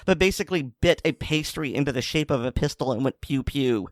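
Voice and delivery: ordinary talk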